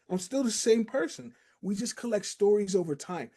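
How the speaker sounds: normal talking voice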